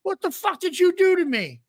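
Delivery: high-pitched panicked yelp; squealing indignation; acted outrage. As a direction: demand answers in high, retold fury. Retold outrage at being burned.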